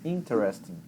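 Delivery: "interesting" pushed on IN, the rest falling away; stress on first syllable. Shown as a demonstration. Teacher contrasting English with Portuguese, force at the start. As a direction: hit IN in "interesting" and coast to the end. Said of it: "forçar o início da palavra".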